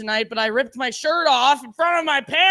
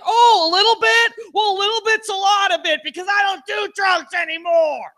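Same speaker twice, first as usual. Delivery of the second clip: loud, high mock outrage; indignant retort; 6 semitones higher, 4 dB louder than usual. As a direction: throw it back in hammered-up indignation. Indignant retort about the dose.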